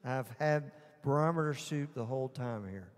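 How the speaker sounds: normal talking voice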